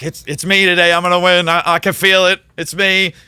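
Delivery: rally the class that you're about to win; excited certainty. Retold or imitated. Telling his classes he'll win.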